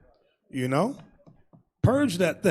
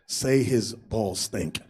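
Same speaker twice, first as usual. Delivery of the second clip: low, slow devilish murmur; Satan whispering; 13 semitones lower, 7 dB quieter, much slower than usual. Acted. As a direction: drag it out low and soft like Satan tempting. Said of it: "Satan goes".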